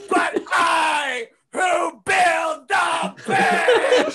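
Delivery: slow, shouted pseudo-archaic speech; hammed-up biblical proclamation; mock grandiose declaration. Answering the children, mock biblical shouting.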